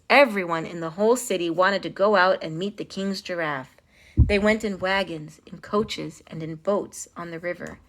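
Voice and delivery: ordinary delivery, nothing marked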